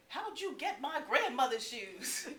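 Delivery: high laughing slow question. Retold or imitated. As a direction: laugh through the teasing question. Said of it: "greeted me with some version".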